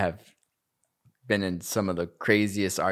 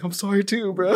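mock emotional apology back; 12 semitones higher, 4 dB louder than usual. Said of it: "he starts to get emotional".

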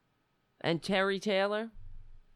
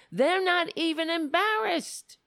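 high, loud outrage; outraged; 9 semitones higher, 3 dB louder than usual. Real, not acted. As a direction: spit out the disbelief. Outraged peak of rant.

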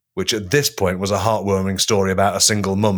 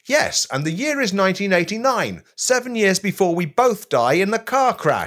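reenacting pop star; 10 semitones higher than usual. Host reenacts London Boys meeting [name], high voice. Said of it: "a reenactment of the scene".

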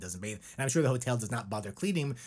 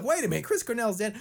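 high, mock realization from a border guard; guard's hammed-up sudden realization, pitched high; mock surprise; 10 semitones higher, 3 dB louder, faster than usual. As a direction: play the guard catching the lie.

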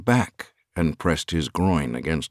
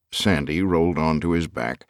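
ordinary delivery

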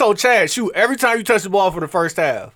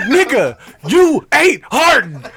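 high, loud and drawn-out mock shout; retold top-of-voice yell; 7 semitones higher, 4 dB louder, slower than usual. Player losing it on teammate in the gym, top-of-voice retold yell. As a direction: yell it slowly, imitating a teammate who lost it. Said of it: "at the top of his voice".

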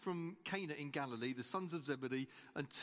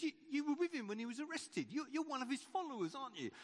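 higher, fast pressing accusation; accusing servant; 7 semitones higher, faster than usual. Acted. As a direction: corner him quickly, voice up high. High priest's servant accuses Peter.

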